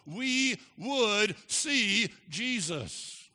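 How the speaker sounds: high, loud, very slow, word by word; slow, soaring pulpit declaration; climactic proclamation. Real, not acted. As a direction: ring out each word to the congregation. Climax of the preacher's quotation.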